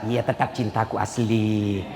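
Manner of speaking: soft, slow, low loving reply; calm her with a soft slow vow; tender reassurance. Retold or imitated. Husband soothes wife over fake gold. (suara lembut menenangkan, pelan)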